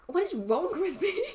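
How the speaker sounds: high, exasperated mock despair at herself; exasperated self-question. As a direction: throw up your hands: what is wrong with me. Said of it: "I'm like".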